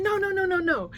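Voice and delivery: high, repeated mock-panicked refusal; Finn balking. Friend told to go say hi, imitated hesitant refusal. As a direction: imitate a shy fan backing out. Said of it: "Finn was a little hesitant to go over".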